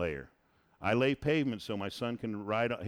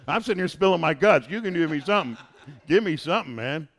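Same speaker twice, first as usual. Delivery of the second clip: loud, higher, playful exasperation; exasperated plea; 4 semitones higher, 9 dB louder than usual. Real, not acted. Leader prodding the group for an example, loud exasperated plea. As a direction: plead loudly for them to open up.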